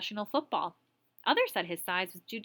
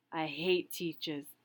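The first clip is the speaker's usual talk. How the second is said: slow, lower gruff big man; lightly voiced gruff Tiny; 4 semitones lower, 3 dB quieter, slower than usual. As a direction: drag it out like a menacing giant. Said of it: "The smile vanished".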